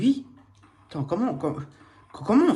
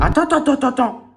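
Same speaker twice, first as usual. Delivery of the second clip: higher and louder, rushed alarm; panicked attends; 8 semitones higher, 7 dB louder, much faster than usual. Real, not acted. Gamer startled by an animatronic, panicked and loud. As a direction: stop everything, something moved.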